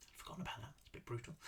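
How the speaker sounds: very quiet, near-whispered aside; hushed off-script remark; muttered aside. Real, not acted. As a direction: drop to a whisper, commenting to yourself. Reader's private aside on the story.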